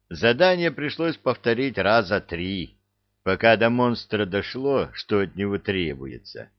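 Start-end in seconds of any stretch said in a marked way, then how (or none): none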